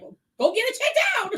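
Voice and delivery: loud, high-pitched exclamation of urging; exasperated urging. Retold or imitated. Friend fed up with reflux complaints, exasperated. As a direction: burst out loudly, fed up with the complaining.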